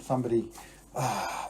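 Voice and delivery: ordinary delivery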